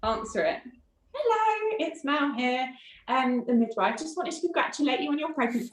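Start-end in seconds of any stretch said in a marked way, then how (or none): 1.1-5.7 high, bright-toned phone greeting from a midwife; chirpy midwife. Retold or imitated. Midwife phoning a grieving mother, cheerily high. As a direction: pitch it up like a cheerful call from the clinic.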